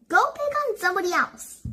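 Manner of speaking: high, fast snap; Dorothy snapping back at the tree; snappy retort. A narrator's reading. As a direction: fire it back quickly and sharply.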